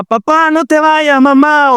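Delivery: put-on high, slow little-boy plea, clinging to his parents; small child begs his leaving parents, high and pleading. Boy Samuel begs papá and mamá to stay, high child voice.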